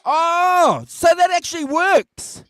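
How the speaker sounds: high, loud amazed outburst; excited exclamation. Real, not acted. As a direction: shout it in joking amazement.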